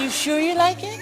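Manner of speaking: a hint of Michael's gentle question. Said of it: "He said".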